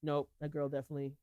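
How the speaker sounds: low quiet mumbling retreat; sheepish backing down. Retold or imitated. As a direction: mumble it as you cave in.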